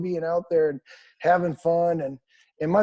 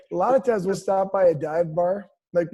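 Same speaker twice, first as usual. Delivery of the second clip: normal talking voice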